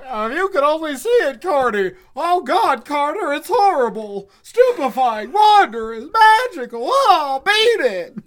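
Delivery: high, loud rising hysteria; frantic awe. Acted. Warren raving to Carter about the horror below. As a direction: rave in terrified wonder. Warren's awestruck, terrified report.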